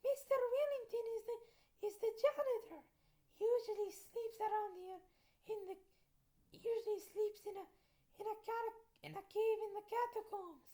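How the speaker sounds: Dracula's voice. Shown as a demonstration. Dracula explaining the janitor, in a high cartoon voice. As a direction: squeak it out as a comic little Dracula. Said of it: "I meant".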